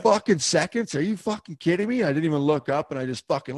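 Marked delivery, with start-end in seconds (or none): 0.0-2.0 high, disbelieving retold outrage; incredulous anger. Bull rider scoffs at the ten-second warning, outraged. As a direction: act stunned and furious at the call. Retold outburst at timing call.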